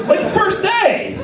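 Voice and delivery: high, slow shouted disbelief; stretched-out incredulous shout; incredulous outburst. Acted. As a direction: yell it like you can't believe it. Outraged comedic outburst.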